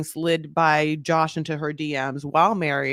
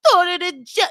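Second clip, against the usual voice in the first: high, loud, drawn-out mock wail; hammed-up hysterical accusing cry; Natalie impression; 10 dB louder, slower than usual. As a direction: shriek it slowly in theatrical accusation. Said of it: "let me do my Natalie".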